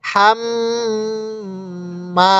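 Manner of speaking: long humming ghunnah between হাম and মা; nasal ghunnah. Shown as a demonstration. Teacher showing learners how to read with ghunnah, long nasal hum on হাম before মা. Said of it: "এখানে গুনাহ করব".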